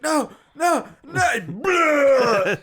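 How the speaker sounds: put-on high, loud wailing kid; mock crying. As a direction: cry it out like a panicking child, hammed up. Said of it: "and he's crying".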